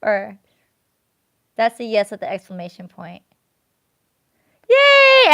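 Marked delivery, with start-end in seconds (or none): 4.7-5.3 very high, loud yay; cheering. Real, not acted. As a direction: shout for joy. Celebrating the yes.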